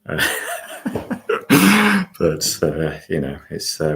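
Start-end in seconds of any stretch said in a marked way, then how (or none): 0.3-2.1 high, loud chuckle at himself; self-conscious laugh. Real, not acted. Host amused at sounding like a meditation pundit, self-conscious laugh. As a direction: laugh at your own earnestness. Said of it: "beginning to sound like a meditation pundit".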